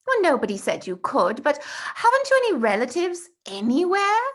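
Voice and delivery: put-on eager young boy Bertie, showing off; eager schoolboy. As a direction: brag about the holidays like an excited schoolboy. Bertie presses Tom.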